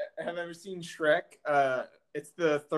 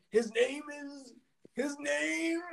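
faltering, slow dying king voice; 8 semitones higher, 5 dB quieter, much slower than usual. Retold or imitated. Shrek's dying king, fading out, slow and faltering. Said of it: "He's like".

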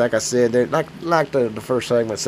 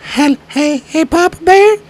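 much higher, louder put-on coworker voice; worried coworker; 9 dB louder, slower than usual. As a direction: call out to him like a nervous coworker. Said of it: "people are like".

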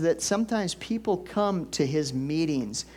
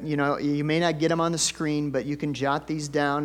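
normal talking voice

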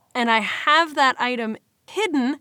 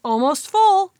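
higher, louder put-on voice; mock label voice; 7 semitones higher, 5 dB louder, slower than usual. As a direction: say it like a silly label. Mocking what people say.

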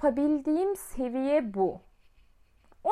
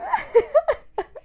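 high, loud disbelieving laugh; burst of laughter; 8 dB louder than usual. Real, not acted. Hearing the guy's absurd demand, she bursts out laughing. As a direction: explode into helpless laughter.

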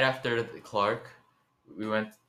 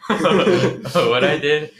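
laughing; 5 semitones higher, 6 dB louder, much slower than usual. Real, not acted. Laughing at his own prank story.

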